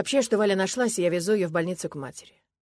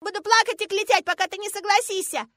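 very high, loud wailing threat; tearful stubborn child; 7 dB louder than usual. A narrator's reading. Girl forcing father to agree, tearfully defiant. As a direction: keep bawling like a small girl.

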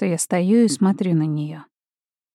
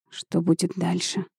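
muffled through a gas mask; 6 dB quieter than usual. Acted. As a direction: speak softly through a mask. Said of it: "приглушённым голосом через противогаз".

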